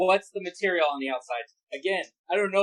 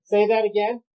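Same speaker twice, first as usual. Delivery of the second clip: muffled by respirator; 2 semitones higher, 5 dB louder than usual. Real, not acted. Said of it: "my voice is gonna get muffled".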